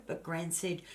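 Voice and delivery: ordinary delivery, nothing marked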